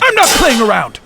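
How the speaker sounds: angry outburst. Acted. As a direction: snap at them to get serious. Leader scolds the squad.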